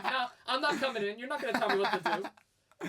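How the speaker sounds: loud, high husky voice with laughter; comic defiance, as a dog refusing orders; protesting dog. Retold or imitated. Husky sitting out in the rain, comically protesting. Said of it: "protesting in the rain".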